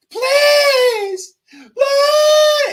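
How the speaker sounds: high, loud falsetto wail with fake crying; mock sobbing plea. Mocking her begging in jail, loud falsetto sob. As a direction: wail it like a hammed-up breakdown. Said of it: "and she's like".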